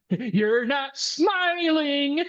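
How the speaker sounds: high, slow, creepy manager voice; twitchy manager voice. Retold or imitated. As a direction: stretch it out high and unsettling. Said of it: "the kind of the twitchy manager guy".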